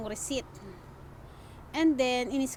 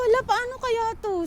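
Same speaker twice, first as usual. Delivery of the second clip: high, anxious retold worry; a touch of mock alarm; nervous panic; 10 semitones higher than usual. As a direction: replay your own rising panic. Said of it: "lalo akong kinabahan".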